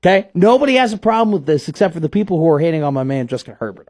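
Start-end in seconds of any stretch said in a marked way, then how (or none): none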